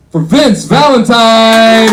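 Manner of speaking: big stage introduction, high and drawn out; loud, stretched-out emcee call; announcer's shout. Real, not acted. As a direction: bellow the name to start the applause. Host bringing on next comedian.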